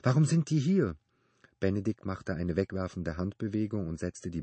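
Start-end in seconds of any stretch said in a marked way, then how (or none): 0.0-1.0 high, puzzled question; baffled. A narrator's reading. Phoebe questioning Benedikt about her things, baffled. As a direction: ask it in raised-pitch bewilderment. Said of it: "sie sah Benedikt verständnislos an".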